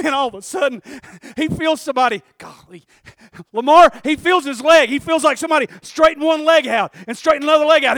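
loud, high preaching climax; full-force preaching. Real, not acted. Preacher at full force telling the Samaritan scene. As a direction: preach it hard to the crowd.

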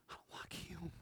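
breathless whisper. Acted. Wounded man whispering his prejudice, soft. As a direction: whisper it to yourself.

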